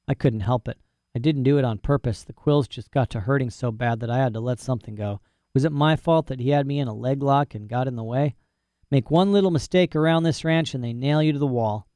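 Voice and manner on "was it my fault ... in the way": plain reading voice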